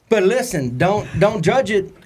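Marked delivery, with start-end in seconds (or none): none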